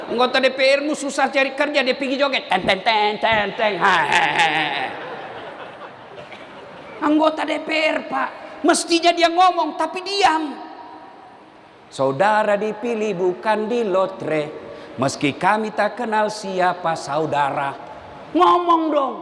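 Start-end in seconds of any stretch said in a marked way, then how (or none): 2.5-4.9 mock dangdut jingle. Imitating dangdut music, laughing. 11.9-17.8 lower, slow, poetic protest lines; slow lowered recitation. Retold or imitated. Preacher reciting a verse to silent MPs, slow and low.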